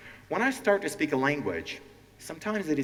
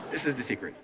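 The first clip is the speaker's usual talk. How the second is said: usual speaking voice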